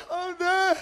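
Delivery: high, loud mock whine; surrendering sister. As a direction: give up in a little sister's wail. Sister giving up.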